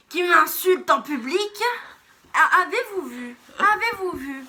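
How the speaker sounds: slow, high, loud hammed-up indignation; mock outrage. Girl pretending her friend insults her in public, hammed-up loud outrage. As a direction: play the wounded victim for the camera.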